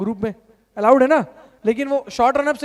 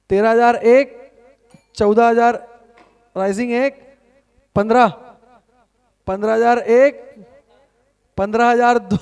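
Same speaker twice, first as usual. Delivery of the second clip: rising bid calls, louder; auctioneer calling bids; 6 dB louder, slower than usual. Acted. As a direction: call out each bid like an auctioneer.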